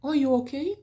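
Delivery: British "Are you okay?" with "are" as "o"; light British accent demo. Shown as a demonstration. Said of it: "pronuncia súper británica".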